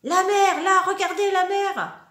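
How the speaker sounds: loud, high cry of discovery; child spotting the sea, thrilled; excited shout. Acted. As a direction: call out to wake everyone. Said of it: "Samy s'écrie".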